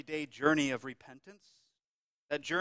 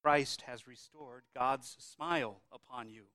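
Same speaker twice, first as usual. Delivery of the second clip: ordinary talk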